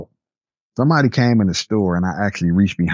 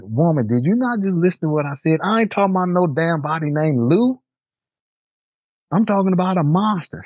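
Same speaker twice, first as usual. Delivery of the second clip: mildly exasperated, higher-pitched complaint; retold indignant protest; 9 semitones higher than usual. Employee correcting boss about the monster, mock indignant. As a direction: act out being indignant at not being heard.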